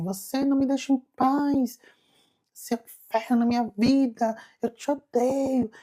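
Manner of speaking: high, tearful furious outburst; crying with rage. Retold or imitated. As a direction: hurl it at her through tears of hate. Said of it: "bati chorando, morrendo de ódio".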